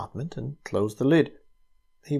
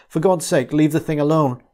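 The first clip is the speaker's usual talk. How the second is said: loud, high desperate cry; 5 semitones higher, 7 dB louder than usual. A narrator's reading. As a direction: cry out in alarm. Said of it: "he cried out".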